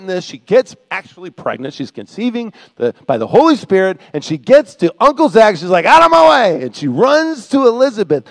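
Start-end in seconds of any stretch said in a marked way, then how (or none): 5.9-6.6 high, loud brush-off; urgent shout. Acted. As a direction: shove past someone with your voice. Acting Mary rushing past uncle.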